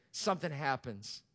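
normal talking voice

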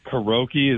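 karaoke said as "kuh-ROH-kee"; host's "karoki" pronunciation. Retold or imitated. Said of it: "is this what you're saying".

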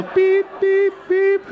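high, even machine beeps; imitated electronic beeps. Pastor mimicking airport machine at check-in, high steady beeping. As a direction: beep like a scanner, all on one high note.

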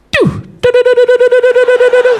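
high repeated doo beeps like a crosswalk signal; crossing signal beeps. Retold or imitated. Comic telling the crowd about Sydney's crossing beeps, high electronic doos. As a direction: mimic the pedestrian signal's beeping. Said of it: "this is what I hear".

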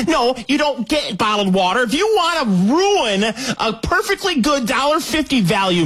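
high-pitched, forceful mock corporate rant; indignant Costco spokesman. Costco telling customers to take the soda, mock-indignant rant. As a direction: lay down the law with exaggerated indignation. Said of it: "You say".

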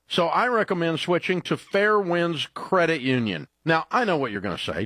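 ordinary talk, nothing marked

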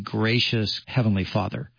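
normal talking voice

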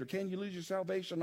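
normal talking voice

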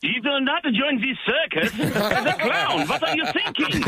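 imitating his parents, high and slow with a laugh; strict parents. Parents telling son not to join the circus, mock-stern high voice.

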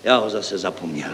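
soft, low, deflated admission; crestfallen. Acted. Deputy admitting he forgot his idea, crestfallen. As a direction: let the voice sink in disappointment. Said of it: "Sesmutnil zástupce stavebního referenta".

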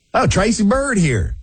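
high, drawn-out country singer voice; country accent. Pretend. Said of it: "I would sound good with a country accent".

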